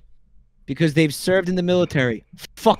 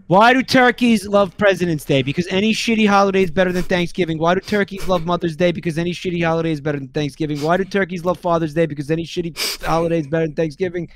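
rushed rapid-fire; faster than usual. Real, not acted. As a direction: speed through it, getting faster. Rattling off the repeated punchline.

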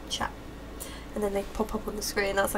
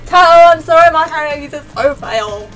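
loud, high shout over music; 3 semitones higher, 13 dB louder than usual. Real, not acted. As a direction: yell it over the track.

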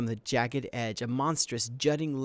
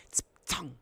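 higher-pitched imitated twang of a bow; bowstring twang; 4 semitones higher, 4 dB quieter than usual. A narrator's reading. Narrator voices the arrow leaving the bow, imitated twang. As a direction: make the arrow's release ring out.

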